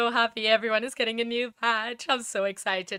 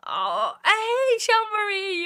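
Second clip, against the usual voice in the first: excited greeting; 10 semitones higher, 4 dB louder, slower than usual. Real, not acted.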